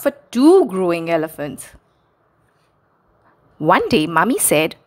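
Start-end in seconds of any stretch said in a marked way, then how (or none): none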